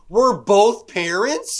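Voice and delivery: high, loud, slow, incredulous. Retold or imitated. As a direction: drag it out in disbelief.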